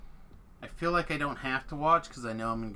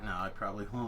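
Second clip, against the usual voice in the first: usual speaking voice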